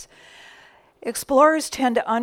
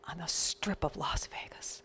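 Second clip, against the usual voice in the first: softer, whispered dream; awed whisper; 3 semitones lower, 11 dB quieter than usual. Retold or imitated. Singer confessing her dream to the minister, awed whisper.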